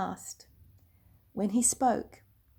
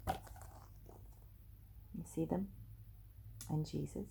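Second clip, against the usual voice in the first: hushed aside; 3 semitones lower, 14 dB quieter than usual. Real, not acted. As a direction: lean in and whisper, pointing at the picture. Aside to the listening child.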